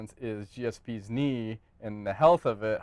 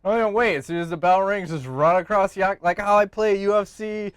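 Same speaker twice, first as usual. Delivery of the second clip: excited; 6 semitones higher, 6 dB louder than usual. Acted. Excited game-plan rant.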